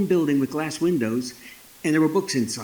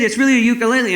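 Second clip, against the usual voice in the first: higher, louder retold insistence; acted-out earnest protest; insistent protest; 8 semitones higher, 6 dB louder, much faster than usual. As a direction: plead your case, stressing it's true. Insisting to a doubting officer.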